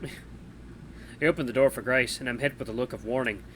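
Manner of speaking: ordinary delivery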